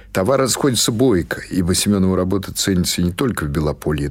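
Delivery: ordinary delivery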